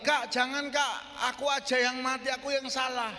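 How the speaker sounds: high, urgent pleading; acted desperate plea. Brother begging to die in his place, high desperate plea. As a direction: beg like a panicked younger brother.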